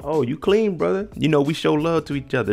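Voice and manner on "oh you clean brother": friendly greeting. Retold or imitated. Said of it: "You see him".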